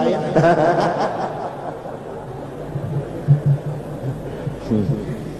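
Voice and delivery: long breathy laugh; high, soft laugh that trails away. Real, not acted. Speaker to listeners after his joke, long soft laughter.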